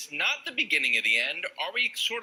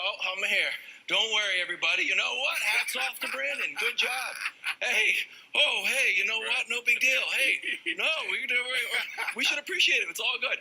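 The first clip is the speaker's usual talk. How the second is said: a higher, lightly hammed-up carefree voice; mock easygoing runner; 5 semitones higher than usual. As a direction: play the relaxed guy coasting through mile one. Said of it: "we saw Nick come out in mile one".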